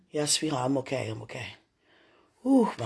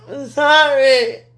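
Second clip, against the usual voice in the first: tearful apology; 8 semitones higher, 7 dB louder, slower than usual. Real, not acted. Sobbing apology on video call.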